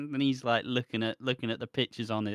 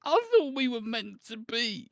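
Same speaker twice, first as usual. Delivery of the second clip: hammed-up heartbroken wail; 11 semitones higher than usual. Jilted man wailing at the woman, high and hammed-up. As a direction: whine it like a betrayed teenager. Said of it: "mocking Anthony again".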